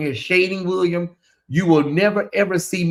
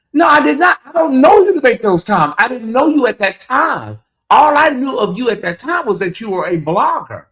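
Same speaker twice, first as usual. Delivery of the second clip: loud, higher-pitched protest at the start, easing a little; heated denial; 6 semitones higher, 5 dB louder than usual. Real, not acted. Defending himself over comments about a blogger, heated denial. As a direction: push back hard, raising your voice.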